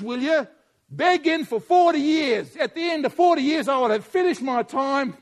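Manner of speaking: loud, driving pulpit voice; fiery sermon delivery; preaching at full force. Acted. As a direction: preach it hard to the congregation.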